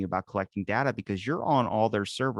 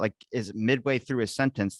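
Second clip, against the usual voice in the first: ordinary delivery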